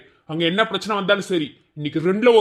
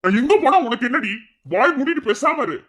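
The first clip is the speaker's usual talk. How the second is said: raised, higher angry voice; angry retort; 7 semitones higher than usual. Acted. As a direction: shut her down hard. Husband snaps at wife. (கோபமான பதிலடி)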